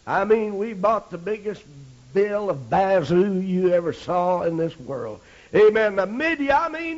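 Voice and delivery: ordinary talk, nothing marked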